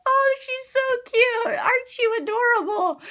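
high-pitched, drawn-out coo; cooing adoration. Retold or imitated. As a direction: gush as if at something adorable. Gushing over an adorable character.

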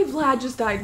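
usual speaking voice

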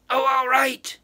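high, loud, irritated snap on all right; the witch gives in with a sharp snap; witch snapping. A narrator's reading. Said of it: "she snapped".

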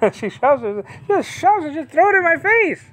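laughing. Real, not acted. Laughing about wife and pamper.

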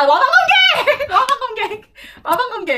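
high, loud eureka shout; excited shout. Real, not acted. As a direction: blurt it out as it comes back. Suddenly recalls the ID, shouts it.

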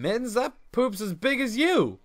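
normal talking voice

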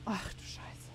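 breathless whispered curse; shocked whisper. Real, not acted. Gamer recovering from a scare, whispering a curse. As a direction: whisper it, still shaken.

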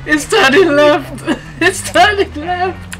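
high, loud frantic cries; losing control and freaking out; panicked. Real, not acted. Car won't steer, panicking.

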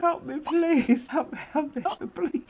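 high, half-laughing plea for help; playful pleading. Pretend. Overwhelmed player begging for help, playfully pleading. Begging amid game chaos.

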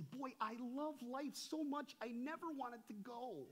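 high, slow gushing quote; mock rapture. Preacher imitates someone in love with life, gushing. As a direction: gush slowly, lightly hammed-up.

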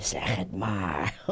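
put-on very low, slow old frog. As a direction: coax in a deep, slow voice. Old frog coaxing young frog. (lage, trage stem van de oude kikker)

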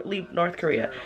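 ordinary talk, nothing marked

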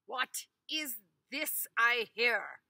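loud, slow, stern demand; a stern lord confronting his peasant; grave angry master. Acted. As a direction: master gravely questioning Ivan. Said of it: "said the master gravely, like he's angry".

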